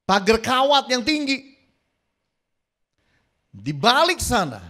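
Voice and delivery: dramatic sermon storytelling; preaching at full force. Acted. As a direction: climb in pitch as the scene unfolds. Preacher building the lion story.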